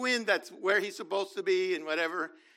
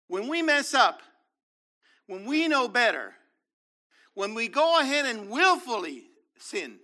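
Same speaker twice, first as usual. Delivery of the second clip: louder, driving sermon; fervent preaching; 4 semitones higher, 5 dB louder than usual. Real, not acted. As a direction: preach it hard. Preaching at full force.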